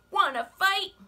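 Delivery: high, loud, grumpy bug; grouchy ladybug voice. Acted. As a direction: bark the challenge up high. Ladybug challenges gorilla.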